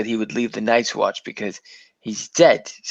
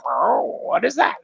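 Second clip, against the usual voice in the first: imitated curious author; 10 semitones higher than usual. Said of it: "And he was like".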